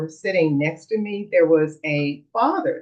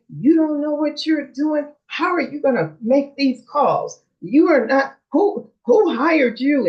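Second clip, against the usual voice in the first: high-pitched, emphatic mock yell; heated heckling, held short of a real shout; retold screaming; 9 semitones higher, 3 dB louder than usual. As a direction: imitate an irate fan berating the refs. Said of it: "he started screaming profanity".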